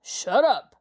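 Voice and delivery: a hint of a rough snarled shut-up; lightly voiced gang boss cutting him off. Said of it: "the leader snarled".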